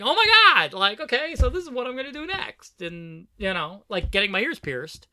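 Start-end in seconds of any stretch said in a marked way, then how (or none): 0.0-2.8 high, loud burst of sudden realization; excited epiphany. Retold or imitated. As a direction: blurt it out like a fresh revelation, pitched up.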